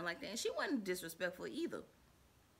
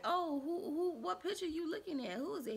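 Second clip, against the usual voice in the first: high nosy question; 8 semitones higher, 3 dB quieter than usual. Retold or imitated. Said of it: "I was like".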